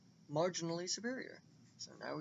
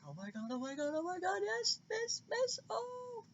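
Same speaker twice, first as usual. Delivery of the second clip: fangirling. Retold or imitated. Fan acting out her nervous cheering for the fighter, squealing. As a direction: squeal like a fan watching the match. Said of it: "I'm gonna stop fangirling now".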